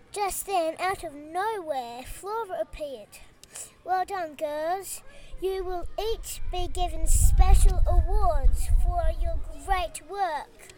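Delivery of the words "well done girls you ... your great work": ordinary delivery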